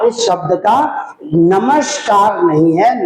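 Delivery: ordinary talk, nothing marked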